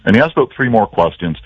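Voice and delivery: ordinary talk, nothing marked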